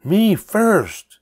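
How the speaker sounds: high piercing loud shriek. A narrator's reading. Pinkerton galloping over the dune, shrieking. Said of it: "Pinkerton shrieked".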